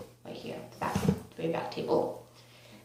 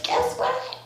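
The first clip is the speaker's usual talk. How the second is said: excited mock scream. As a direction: squeal it like an excited friend. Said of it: "Like, screaming".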